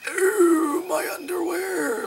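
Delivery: high, slow mock alien voice with a sudden yelled burst; mock alien whisper. Mocking the movie's aliens for viewers, hushed mock whisper. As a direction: ham up the alien people's strange hushed voice. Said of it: "all speak out a whisper".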